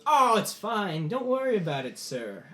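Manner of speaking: high, loud lordly dismissal dropping on "sir"; breezy reassurance. Acted. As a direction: wave away the apology grandly. Lord breezily reassures bowing father.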